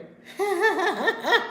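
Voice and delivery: high, loud giggling laugh; real laughter. Real, not acted. Host amused at reaction to her hairdo, laughing.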